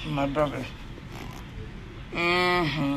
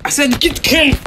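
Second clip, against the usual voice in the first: high, loud outburst; angry order; 10 semitones higher, 7 dB louder, much faster than usual. Acted. Wrestler ordering the camera out of his face, angry shout. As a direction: shout the repeated demand.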